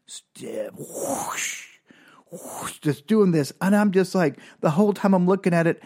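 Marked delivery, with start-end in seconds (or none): 0.8-2.8 airy swishes, spaced apart; soft whooshes with a pause between; imitated whoosh. Dad unwinds the rubber band, imitated soft whooshes. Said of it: "slower and louder".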